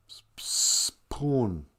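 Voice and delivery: "spoon" with a stuck, long s before "poon"; childhood mispronunciation. Retold or imitated. Child trying after correction, "spoon" split after the s. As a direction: hiss the s, stop, then say "poon". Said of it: "I couldn't say spoon".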